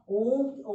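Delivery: Om held long and loud like a chant; teacher demonstrates the prolonged vowel in ओम; drawn-out Om. Shown as a demonstration. Teacher showing pupils a three-beat vowel, long chanted Om. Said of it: "ओम का मात्र अनु निकलता है".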